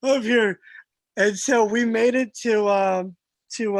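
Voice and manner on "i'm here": loud call from behind, pushed out; loud retold call from a worn-out climber. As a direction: shout up to him that you're still there. Retold friend calling back loudly.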